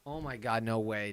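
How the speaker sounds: ordinary delivery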